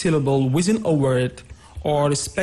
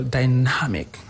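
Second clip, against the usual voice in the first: "dynamic" stressed on NAM; second-syllable stress; 3 semitones lower than usual. Shown as a demonstration. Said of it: "on the second syllable".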